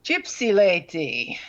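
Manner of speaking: ordinary delivery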